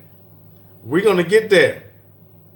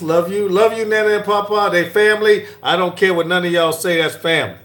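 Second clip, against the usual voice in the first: ordinary delivery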